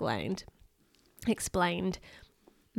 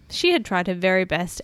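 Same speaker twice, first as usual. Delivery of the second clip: ordinary delivery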